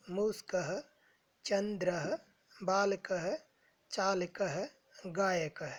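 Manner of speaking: each word read slowly with the final visarga sounded as a breathy echo "-əhə"; teacher reading a word list aloud for students; careful Sanskrit recitation. Shown as a demonstration. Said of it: "इन शब्दों का केवल उच्चारण करना है".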